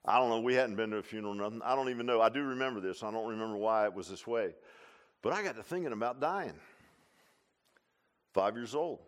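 ordinary talk

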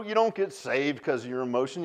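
ordinary talk, nothing marked

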